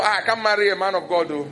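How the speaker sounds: ordinary delivery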